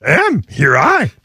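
high, lightly voiced Yoda phrasing. Cohost trying Yoda lines, a hint of Yoda. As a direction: toy with Yoda's word order. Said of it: "in your Yoda voice".

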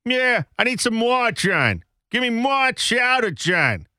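high, hammed-up Boston demand; mock a Bostonian, dropping the Rs; Boston accent. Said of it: "how they get the letter W".

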